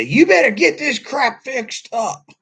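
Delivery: high, loud, slow demand; acted anger. Shown as a demonstration. Showing how to get angry without sinning, angry demand. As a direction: lay down the law in mock fury. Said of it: "get angry at 'em and say".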